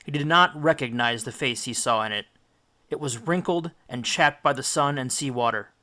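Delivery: usual speaking voice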